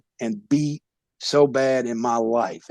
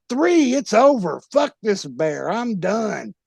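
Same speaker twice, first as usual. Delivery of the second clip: hollered surrender; 7 semitones higher than usual. Retold or imitated. Beaten wrestler hollering to the referee, yelled surrender. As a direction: holler that you're done. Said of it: "I holler".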